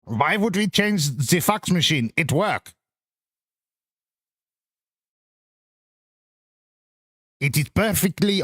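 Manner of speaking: a hint of a German accent, barely there; a clumsy half-attempt at a German accent; mock German accent. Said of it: "I can't do a German accent".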